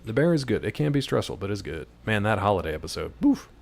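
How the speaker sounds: usual speaking voice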